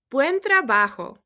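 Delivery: loud, high, upbeat praise; cheerful praise. Real, not acted. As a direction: celebrate the answer out loud. Teacher praising student answers.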